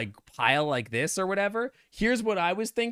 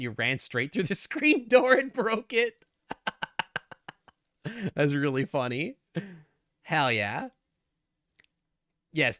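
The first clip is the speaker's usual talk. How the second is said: ordinary talk, nothing marked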